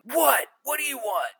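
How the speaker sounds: high-pitched, annoyed outburst; irritated snap. Retold or imitated. Man tapped on the shoulder turns on Tom, irritated snap. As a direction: snap at someone who has interrupted you. Stopped stranger snaps at Tom.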